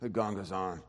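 hegongazon muttered low and soft, like a grumble; mumbled under breath. Shown as a demonstration. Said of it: "if you say it under your breath".

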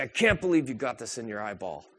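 picking out a speck while lecturing, mock scolding; judgmental scolding. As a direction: scold them like a nosy critic. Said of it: "to say".

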